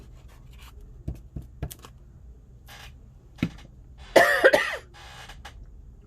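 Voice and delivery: loud coughing fit; harsh cough. Real, not acted. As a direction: hack out a cough. Coughing fit while crafting.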